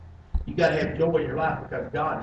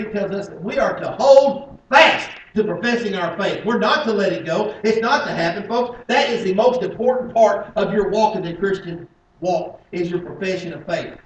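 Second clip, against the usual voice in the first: loud, raised preacher's delivery; higher, louder sermon voice; forceful preaching; 5 semitones higher, 11 dB louder than usual. Acted. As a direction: drive it home from the pulpit.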